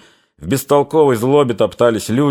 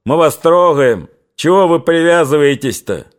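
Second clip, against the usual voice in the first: higher-pitched, raised, irritated voice; angry nasal cry; 6 semitones higher, 4 dB louder than usual. A narrator's reading. Stooped lead soldier rounding on Cossacks, angry nasal cry. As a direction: complain angrily in a nasal voice. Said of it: "закричал злым гундосым голосом".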